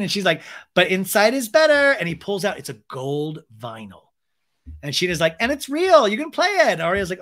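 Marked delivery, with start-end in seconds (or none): 0.7-2.0 high, loud mock squeal; hammed-up high excitement; imitated excited reveal. Acts singer showing off album. 5.4-6.7 high, loud mock excitement; hammed-up squeal of pride; imitated giddy boasting. Acts singer thrilled with album.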